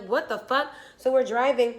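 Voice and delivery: ordinary talk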